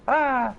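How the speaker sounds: high playful scream, echoing; a toddler's happy shriek, retold; imitated toddler scream. Dad imitating his daughter's slide scream, high playful shriek. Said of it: "look over at me and scream".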